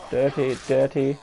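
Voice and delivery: usual speaking voice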